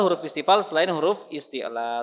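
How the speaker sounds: usual speaking voice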